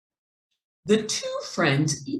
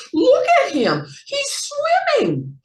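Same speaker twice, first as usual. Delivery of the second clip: much higher, louder amazed quack; loud excited quacking; 6 dB louder than usual. A narrator's reading. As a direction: shout the news across the pond. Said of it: "quacked at the top of their lungs".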